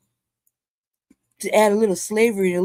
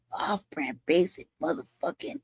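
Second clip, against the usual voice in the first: breathy disgusted whisper; 5 semitones lower, 7 dB quieter, slower than usual. Real, not acted. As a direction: sigh it out under breath. Disgusted aside after rant.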